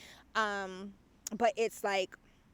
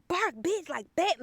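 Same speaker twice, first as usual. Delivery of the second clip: mock commanding; 7 semitones higher, 5 dB louder than usual. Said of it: "where I was like".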